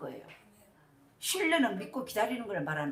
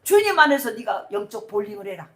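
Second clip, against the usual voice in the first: high, loud, emphatic sermon delivery; forceful preaching; 6 semitones higher, 9 dB louder than usual. Real, not acted. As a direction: drive the point home loudly to the congregation. (힘주어 외치는 설교조)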